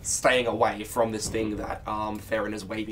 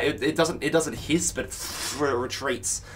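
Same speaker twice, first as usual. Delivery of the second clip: usual speaking voice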